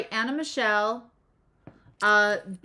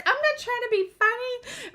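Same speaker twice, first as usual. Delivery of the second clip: imitating mom, higher and dead earnest; dead serious mom. Imitating mom to grandma-joke listeners, pitched-up dead-serious insistence. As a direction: mimic mom insisting she means it, pitched up. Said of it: "she was serious".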